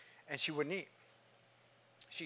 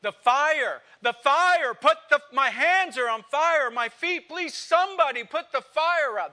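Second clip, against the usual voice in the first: high, loud anguished screams of burning; screaming in agony; 11 semitones higher, 10 dB louder than usual. Retold or imitated. Preacher acting a dying man's scream to the congregation, loud and high. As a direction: cry out in torment, begging for help. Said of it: "You know what they scream?".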